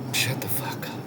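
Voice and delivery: hushed, scornful whisper; whispered retort. Real, not acted. Coach brushing off a napping excuse, whispered. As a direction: breathe the dismissal under your breath.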